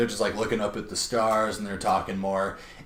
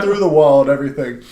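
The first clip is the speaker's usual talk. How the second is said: ordinary delivery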